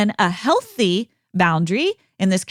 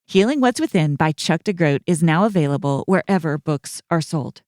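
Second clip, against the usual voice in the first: ad read; 4 semitones lower than usual. Acted. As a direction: read it like a gentle book ad. Sponsor ad read.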